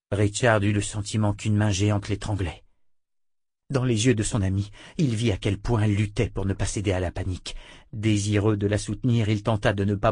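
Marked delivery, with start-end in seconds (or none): none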